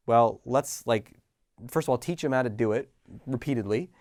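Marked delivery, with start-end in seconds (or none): none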